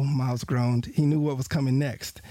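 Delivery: usual speaking voice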